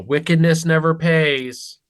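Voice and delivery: put-on loud, earnest Boy Scout voice; eager scout kid. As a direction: proclaim it like a proud Boy Scout. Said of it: "in a very loud Boy Scout-like voice".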